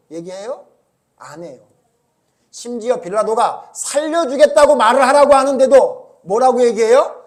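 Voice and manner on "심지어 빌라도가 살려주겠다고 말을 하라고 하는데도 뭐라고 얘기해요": loud, higher, driving sermon voice; fervent preaching. Acted. Preacher scolding the congregation, loud fervent preaching. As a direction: press the point hard to the congregation.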